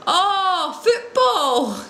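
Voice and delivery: high, drawn-out acted "aha"; sudden realisation. Visitor finally understanding the kicking mime, acted realisation.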